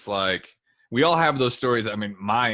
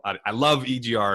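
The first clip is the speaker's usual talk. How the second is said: ordinary talk, nothing marked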